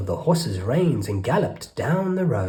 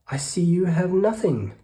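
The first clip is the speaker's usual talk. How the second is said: complaining rat; 7 semitones higher than usual. Acted. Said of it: "the rat complained".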